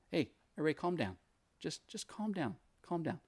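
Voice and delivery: soft, hushed peacemaker; calmness. Acted. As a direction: step in and quietly settle everyone down. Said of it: "interesting to see that calmness".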